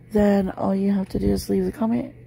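usual speaking voice

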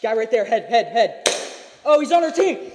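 high, loud urgent callouts; yelling over the gunfire; shouting callouts. Real, not acted.

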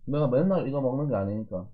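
talking between bites; chewing. Real, not acted. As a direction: mouth partly full. Seen 0: Lips and cheeks move with food between bites; spoon-and-chopsticks eating throughout.

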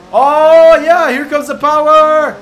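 loud, high hype yell; excited shout. Real, not acted. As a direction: shout as the speed kicks in. Boost kicks in.

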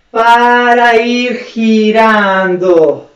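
very slow, elongated words; stretched out. Shown as a demonstration. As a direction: draw each word out along with the turn. Voicing the continuous turn. (palabras muy lentas y alargadas)

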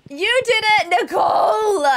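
high, loud cheering shout; excited shout. Real, not acted. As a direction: cheer her on loudly. Cheering a chat member.

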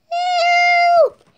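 very high, loud squeal; cry for help. Acted. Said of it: "He's saying help".

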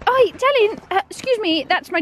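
high fond coo; cooing at dog. Real, not acted. Cooing at the dog jumping on her jacket. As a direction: soften it for the dog.

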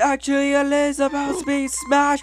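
high, loud amazed shouting; ecstatic disbelief. Acted. Player announcing Isabelle in Smash, ecstatic disbelief. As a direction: shout it out to everybody, overwhelmed.

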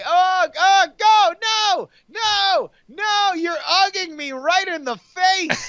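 high, mock-anguished shouting; hammed-up agony. Player pretending to be ugged in the face, high hammed-up shouts. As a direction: wail like a victim under attack. Acting out being attacked in game.

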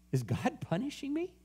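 soft, high-pitched question; quiet question climbing at the end. Retold or imitated. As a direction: lift it high and wondering. Question repeated by preacher.

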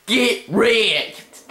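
high, drawn-out gloat; triumphant taunt. Real, not acted. Gamer finishing off opponent, high gloating taunt.

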